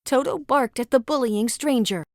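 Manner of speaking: ordinary delivery, nothing marked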